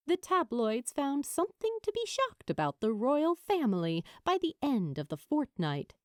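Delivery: usual speaking voice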